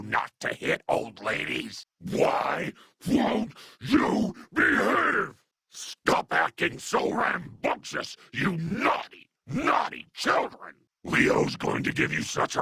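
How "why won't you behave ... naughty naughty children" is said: high, drawn-out scolding wail; slow, high-pitched cry at naughty children; exasperated scolding. Acted. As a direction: stretch out each word in exasperation. Scolding enemies in combat.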